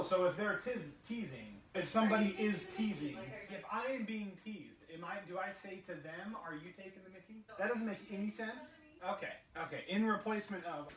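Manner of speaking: a quick off-mic question to his wife, voice falling far away; aside off mic. Real, not acted. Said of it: "I apologize for that brief intermission".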